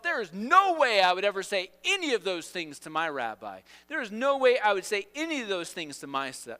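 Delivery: acted emphatic disbelief; vehement protest. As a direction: insist it could never happen. Acting Peter's vehement thought.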